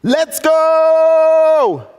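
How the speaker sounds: loud, high, drawn-out battle cry; full-volume rallying yell; war cry. Retold or imitated. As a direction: holler it like a gamer's war cry, stretching the last word. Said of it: "he cries out".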